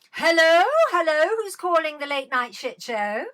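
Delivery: loud, high, ringing hello; showy phone greeting. Real, not acted. Host answers a Late Night Shit Show caller, showy.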